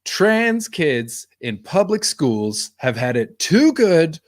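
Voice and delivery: very slow, each word dragged out deadpan; slow deadpan quote. Retold or imitated. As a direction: stretch the words like a sly punchline. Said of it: "Nick Kroll goes".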